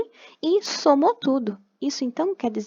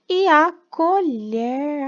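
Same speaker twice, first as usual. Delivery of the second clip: "e a colher" stretched very slow, higher and louder; slow, emphatic naming of the picture; drawn out; 5 semitones higher, 6 dB louder, much slower than usual. Shown as a demonstration. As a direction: draw out "e a colher" syllable by syllable. Teacher stretching word for children.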